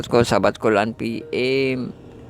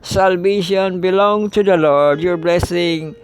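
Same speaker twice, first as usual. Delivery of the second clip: higher, louder declaiming of the psalm; scripture reading; 6 semitones higher, 3 dB louder, slower than usual. Acted. Teacher reading the close of Psalm 3 to the class, raised declaiming voice. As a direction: proclaim the verses to the congregation.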